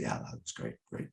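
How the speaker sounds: low, barely voiced praise; muttering. Eccentric man praising a fellow performer during the show, muttering. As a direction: mutter it very softly, as if to yourself. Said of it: "He was just muttering to me".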